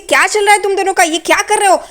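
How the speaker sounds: high, acted demand for an explanation; indignant questioning. Renuka walks in on the beating, acted indignant questioning. Renuka confronts the two girls.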